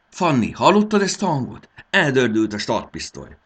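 high, loud, fired-up yell; breathless excitement at full voice; heated shouting. A narrator's reading. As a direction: shout over the start of a race. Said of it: "kiabált Marci felhevülten".

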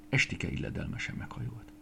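ordinary delivery, nothing marked

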